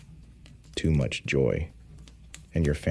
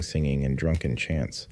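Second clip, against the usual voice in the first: ordinary delivery, nothing marked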